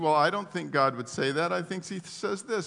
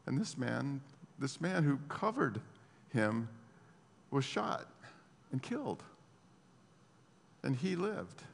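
hushed telling; 4 semitones lower, 9 dB quieter than usual. Acted. Preacher hushes at soldier's death.

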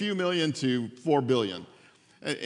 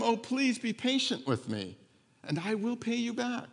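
higher and softer, begging meekly; soft high pleading; 6 semitones higher, 5 dB quieter than usual. Acted. Fellow servant on his knees begging, soft and high. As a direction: plead for patience, small and quiet.

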